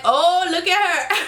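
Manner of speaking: high, giggling mock gasp; giggly imitation of Keely; laughing quote. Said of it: "Keely was like".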